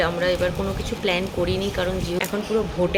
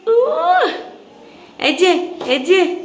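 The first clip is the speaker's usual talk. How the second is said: excited squeal; 11 semitones higher, 4 dB louder, much slower than usual. Real, not acted. Squeal to toddler.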